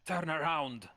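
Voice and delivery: loud, high challenge; sharp shouted guard order; guards' command. Acted. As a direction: order an intruder to stop. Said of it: "they turn around in unison and say".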